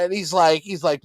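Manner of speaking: normal talking voice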